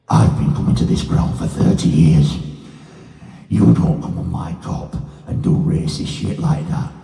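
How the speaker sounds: loud, harsh rasping threat; she's not messing about, rasp it hard and loud; fierce telling-off. Retold or imitated. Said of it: "And she went".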